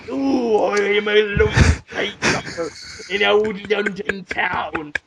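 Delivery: put-on old London voice, slowing at the end; crooned mock old-time Londoner; old London character. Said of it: "And go".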